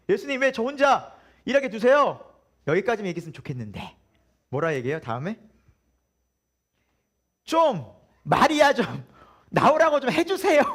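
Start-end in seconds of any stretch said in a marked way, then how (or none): 0.1-2.2 raised, louder whiny complaint to Jesus; irritated complaint. Retold or imitated. Martha complaining to Jesus about working alone, irritated and raised. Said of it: "짜증 섞인 말이 나왔겠죠". 7.4-10.6 higher and louder, slow laughing order; half-laughing, drawn-out command; bossy demand. Retold or imitated. Martha ordering Jesus to send Mary out, a slow bossy laughing demand. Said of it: "하고 명령하고 있어요".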